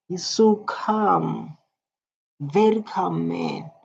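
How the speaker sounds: slow, savoured praise; slow and admiring. Real, not acted. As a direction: linger on each word. Admiring the calm speaker.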